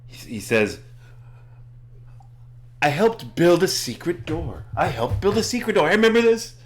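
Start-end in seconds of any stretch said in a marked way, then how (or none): 2.8-6.5 high-pitched, mounting excitement; really excited. Acted. Elmo remembers helping build a secret door, really excited. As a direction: burst out with it, rising higher and faster. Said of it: "he starts getting really excited".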